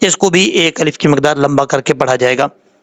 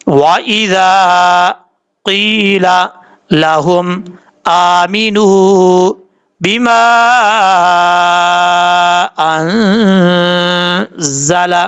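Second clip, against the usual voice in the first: slow melodic tajweed chanting; Quran recitation; much slower than usual. Shown as a demonstration. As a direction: recite each word slowly in a chanting tune. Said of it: "پہلے ہم اس کو practice کے طور پہ پڑھ لیتے ہیں". (تجوید کے ساتھ آہستہ تلاوت)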